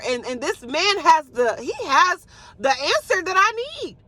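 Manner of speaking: high, sassy put-on girlfriend voice; sassy Mary. Mary brushing off her sister Martha, in a high sassy voice. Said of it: "And Mary's probably like".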